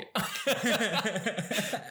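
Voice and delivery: high rising laugh, acting out the officer cracking up; the officer can't stop laughing at the form, high bursting laugh; big mock laugh. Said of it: "il a explosé de rire".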